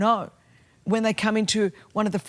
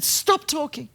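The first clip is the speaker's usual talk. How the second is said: higher, pleading stop; retold angry cry; 7 semitones higher, slower than usual. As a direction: replay her cry once more. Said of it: "She said".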